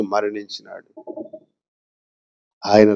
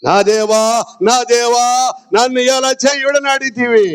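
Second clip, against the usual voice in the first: high-pitched cry to God; high, sustained cry of appeal; crying out loudly; 10 semitones higher than usual. Acted. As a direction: call out as Jesus on the cross. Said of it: "బిగ్గరగా పలుకుతాడు ఆ మాట".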